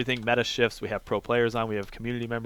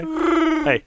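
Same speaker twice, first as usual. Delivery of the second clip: silly squeak; 6 dB louder than usual. Acted. Playful silly voice banter.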